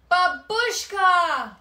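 long, high, loud joyful call; joyful cry. Acted. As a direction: cry out her name in relief. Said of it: "Victor cried".

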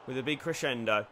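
ordinary delivery

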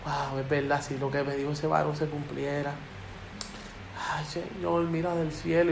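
very soft, low inner voice; hushed pondering. Acted. Man alone at home pondering God's word, hushed. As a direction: murmur it as a private prayer. Voices someone's private night-time thought.

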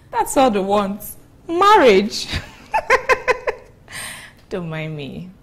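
loud, high, laughing scoff; loud amused disbelief, breaking into laughter; mocking amusement. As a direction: crack up at the very idea of marriage. Laughs mockingly about marriage.

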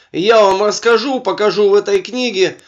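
ordinary talk

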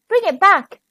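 high, insistent call; mildly urgent storybook exclamation; urgent cry. A narrator's reading. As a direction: plead for quick action. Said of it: "cried Bertie".

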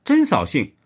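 mild sulky complaint; pouting. A narrator's reading. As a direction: grumble it like a spoiled girlfriend. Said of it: "周志英嘟着嘴说".

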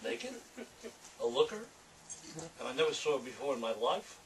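a hint of a brooding noir narrator; noir monologue reading. Author reading his noir piece aloud, lightly brooding. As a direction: muse aloud like a hard-boiled confession.

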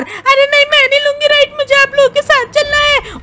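put-on high, loud childlike protest; protesting squeaky companion. Companion refuses to leave the group, protesting high voice.

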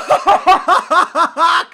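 loud, high cackle; exultant laugh. Real, not acted. Gamer celebrates a goal, exultant laughing. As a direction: burst into triumphant laughter.

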